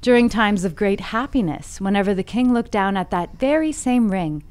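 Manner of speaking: softening, measured fable storytelling; story narration. A narrator's reading. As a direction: bring the fable to a quiet, deliberate close. Reads the fable aloud.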